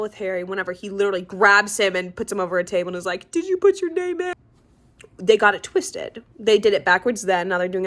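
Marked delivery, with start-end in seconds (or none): 3.3-4.3 high-pitched, urgent mimicked demand; mock frantic interrogation. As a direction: act out a frantic, accusing question. Mimics Dumbledore grabbing and confronting Harry.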